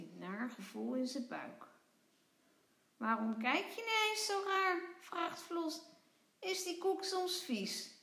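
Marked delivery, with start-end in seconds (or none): 3.0-7.9 high, bright-eyed tiny creature voice; playful little creature calling; little creature Vlos. Acted. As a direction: squeak it out like a small cheeky critter. Vlos asks puzzled.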